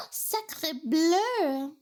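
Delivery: gasp, then exclaim in over-the-top French; sahte Fransız şaşkınlığı. Pretend. Mock French outcry at a joke.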